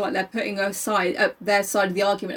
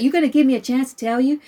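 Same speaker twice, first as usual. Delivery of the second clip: put-on child's voice; 4 semitones higher, 5 dB louder than usual. Child voice again.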